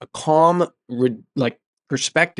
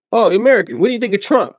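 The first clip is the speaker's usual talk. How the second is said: higher, louder, eager questioning voice of a Russian woman; mock Russian woman; 7 semitones higher, 3 dB louder, faster than usual. Russian woman in the hostel quizzing the American, higher and louder. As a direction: play the curious Russian roommate asking about Trump.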